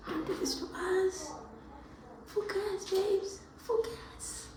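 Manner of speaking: high, breaking plea to focus; high sobbing encouragement; crying plea. Real, not acted. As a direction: beg yourself through sobs. Crying self-talk.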